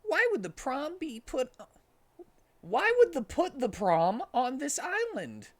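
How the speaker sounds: very high, confused question; baffled little sidekick; puzzled Tails. Acted. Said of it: "said Tails".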